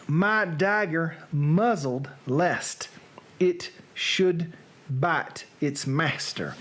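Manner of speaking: slow Southern drawl, halting between phrases; measured Southern-accented Shakespeare. Acted. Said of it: "read now in a Southern accent".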